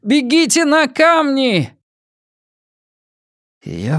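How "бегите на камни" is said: high, stretched urgent yell; shouting. A narrator's reading. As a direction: shout the order across a distance. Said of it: "кричал Ки". (громкий протяжный крик)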